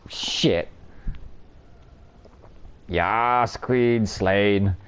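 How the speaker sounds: ordinary delivery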